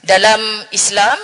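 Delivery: ordinary talk, nothing marked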